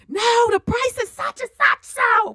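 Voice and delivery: high, excited calling out prices; game show contestant. Retold or imitated. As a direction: call out a price at the TV. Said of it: "working like".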